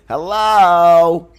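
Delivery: high, loud drawn-out hello; camp producer hello. Retold or imitated. Retelling a Frasier producer's greeting, high camp hello. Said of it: "They say".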